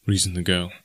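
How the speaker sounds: usual speaking voice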